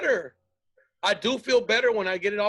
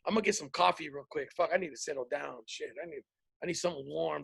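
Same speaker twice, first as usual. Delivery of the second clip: quiet settling; 5 semitones lower, 8 dB quieter than usual. Retold or imitated.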